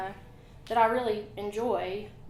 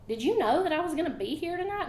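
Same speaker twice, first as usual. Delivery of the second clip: excited question; 7 semitones higher, faster than usual. Retold or imitated. Retelling amazed reaction.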